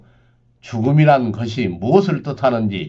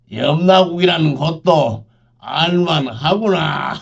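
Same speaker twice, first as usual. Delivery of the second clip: sneering; 5 semitones higher than usual. Acted. Sneering old retainer.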